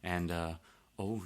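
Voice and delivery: usual speaking voice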